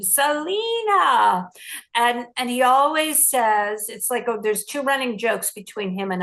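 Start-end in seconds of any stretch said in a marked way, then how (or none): 0.0-1.5 drawn-out, higher-pitched call of a name; sing-out hail from behind a curtain; President Trump. Retold or imitated. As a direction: imitate someone calling out a greeting, stretching the vowels. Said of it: "I can hear him as I'm going around this curtain".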